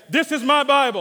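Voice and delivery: shouted, high and victorious; triumphant. Acted. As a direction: declare it like you've already won. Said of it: "say it like we're victorious".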